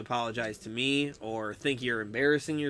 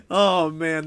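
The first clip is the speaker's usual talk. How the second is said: normal talking voice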